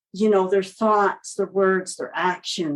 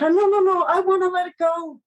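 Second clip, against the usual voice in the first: imitating frantic child; 9 semitones higher than usual. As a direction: panicky mock pleading, pitched way up. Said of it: "he became frantic".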